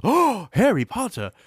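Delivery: high, breathy-free mock gasp of awe; imitating a fan swooning over a celebrity; starstruck gush. Fans meeting Harry, a high mock starstruck gush. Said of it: "Everybody else goes".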